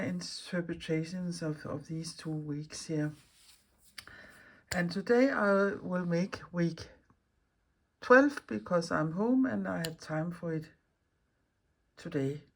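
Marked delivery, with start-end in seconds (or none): none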